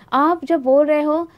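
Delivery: ordinary delivery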